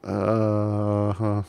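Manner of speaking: ordinary delivery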